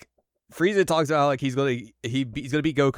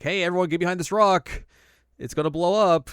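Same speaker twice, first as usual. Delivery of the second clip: warning call; 8 semitones higher, 6 dB louder than usual. Retold or imitated. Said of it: "Gohan's like".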